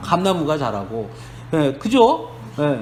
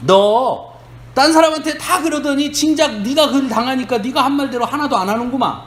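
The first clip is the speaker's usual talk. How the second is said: higher, pointed scolding; reproachful rebuke; 7 semitones higher than usual. Retold or imitated. Eliphaz scolds Job for failing his advice, sharp rebuke. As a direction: throw his own words back at him. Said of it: "이렇게 얘기하는 거죠".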